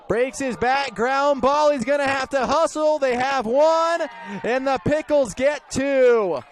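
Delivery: excited play-by-play. Real, not acted. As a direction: shout it as the runner races. Live call of a close play.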